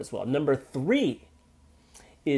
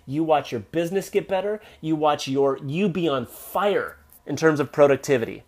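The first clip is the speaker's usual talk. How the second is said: usual speaking voice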